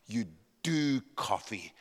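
slow, low "You do coffee"; solemn sermon point. Real, not acted. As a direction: deliver the moral with measured weight. Preacher repeats son's phrase as moral.

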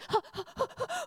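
high startled gasp; sharp gasp. Retold or imitated. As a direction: gasp in shock. Mother shocked, laptop flying.

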